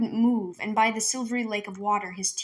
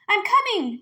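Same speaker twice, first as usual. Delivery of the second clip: higher, louder call; 12 semitones higher, 6 dB louder than usual. Acted. Girl to the trapped voice, calling out. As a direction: call back across the water. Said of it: "Minley called".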